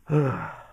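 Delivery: audible let-out sigh, retold; heavy sigh. Son freezes, looking at magazine, resigned sigh. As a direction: sigh it out after a frozen moment. Taking in mom's confusion.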